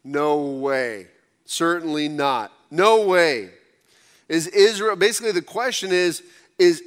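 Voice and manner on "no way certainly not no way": louder at the end, firmly denying; emphatic no way. Shown as a demonstration. Pastor showing the emphatic answer the verse wants, firm denial. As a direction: say it like a flat refusal. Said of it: "elicit the emphatic".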